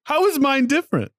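very high-pitched protest; indignant challenge. Real, not acted. Friend challenged about their tone, indignant.

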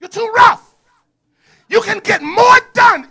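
high, loud put-on voice; lightly voiced mock scold; mock rough voice. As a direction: tease her roughness. Mock voice continues.

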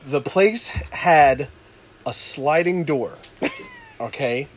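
ordinary delivery

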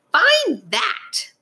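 high-pitched taunting sneer. A narrator's reading. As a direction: taunt with a triumphant challenge. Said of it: "sneered the bird".

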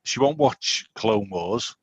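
ordinary talk, nothing marked

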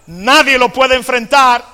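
loud, high, slow cry of despair; loud preaching climax; full-force preaching. Acted. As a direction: hammer each no puedo to the back row. Preacher voicing Isaiah's despair.

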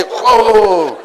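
high, loud drawn-out whoa; loud mock astonishment; amazed whoa. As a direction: gasp at the heavy net. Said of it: "And they".

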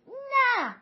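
high, loud screamed refusal; piercing acted shriek; child's scream. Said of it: "لورنا جیغ کشید".

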